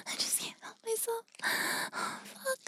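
high breathy whisper; quiet breathing. Acted. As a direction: breathe it out, barely voiced. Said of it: "I have to be quiet".

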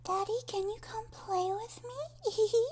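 put-on tiny, sweet little-girl plea. Shown as a demonstration. Demonstrating the little voice, high childlike plea. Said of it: "that's the little voice".

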